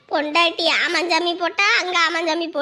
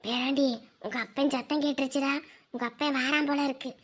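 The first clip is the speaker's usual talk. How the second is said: low, much softer conspiratorial murmur to the grandson; hushed scheming; 6 semitones lower, 9 dB quieter than usual. Acted. Grandmother secretly coaching her grandson, hushed and scheming. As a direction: keep it down so the father won't hear.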